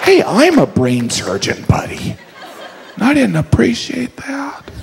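high, slow whispered complaint; hurt, hushed rebuke; offended surgeon. Acted. Brain surgeon offended by the joke, slow and hushed.

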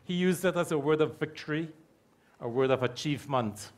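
normal talking voice

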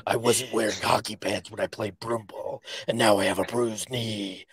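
low, soft vigilante voice; low, muted Batman impression. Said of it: "some of the lines from Batman".

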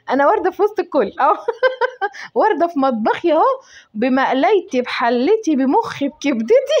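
tickled by your own silly boast; playful laughing boast. Real, not acted. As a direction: show yourself off, laughing between phrases. Joking self-praise among her pans, laughing.

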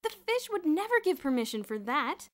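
very loud, high-pitched objection; alarmed protest. A narrator's reading. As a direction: protest loudly in disbelief. Boots keeps crying out in disbelief.

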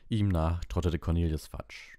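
ordinary delivery, nothing marked

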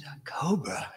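hushed, scared whisper; whispered alarm. Retold or imitated. Hearing it's a cobra, a frightened whisper. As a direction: whisper it like the word itself is dangerous.

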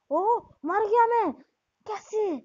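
high, loud cry of disbelief; shocked outcry. Real, not acted. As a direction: yelp it as you get killed without warning. Killed suddenly in the game.

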